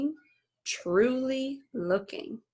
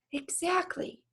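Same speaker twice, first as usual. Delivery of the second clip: high, soft sing-song yes; playful daughter agreeing; cheeky; 10 semitones higher, 5 dB quieter than usual. Acted. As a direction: answer sweetly sure.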